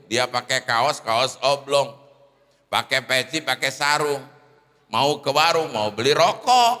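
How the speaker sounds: ordinary talk, nothing marked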